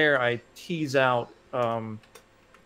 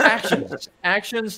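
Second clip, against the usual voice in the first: excited; 9 semitones higher, 6 dB louder, slower than usual. Real, not acted. Excited correction amid laughter.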